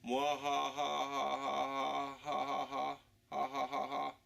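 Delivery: slow theatrical mwa-ha-ha; evil laugh. Pretend. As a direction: cackle like a cartoon villain. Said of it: "Give us an evil laugh".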